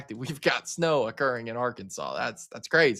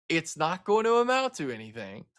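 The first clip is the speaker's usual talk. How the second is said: ordinary talk, nothing marked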